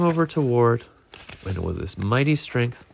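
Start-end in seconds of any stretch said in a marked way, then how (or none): none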